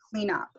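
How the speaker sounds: "clean up" run together, the n carried into "up"; no stop between "clean" and "up"; linked words. Shown as a demonstration. Teacher showing students how words link, run together. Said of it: "I don't stop here".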